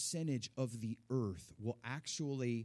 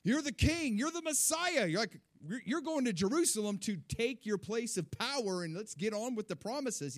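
high, loud, eager protest; imitated dismissive disciples; 7 semitones higher, 4 dB louder than usual. Disciples brushing off Jesus's warning, excited imitated denial. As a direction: wave it off, pitched way up, hyped. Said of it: "they were just like".

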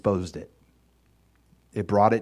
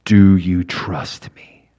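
low, slow, calm voice of God; God's voice; 5 semitones lower, 9 dB louder, much slower than usual. Retold or imitated. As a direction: let each word land slowly and low. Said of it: "And God says".

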